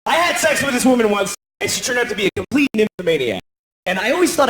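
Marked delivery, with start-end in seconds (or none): none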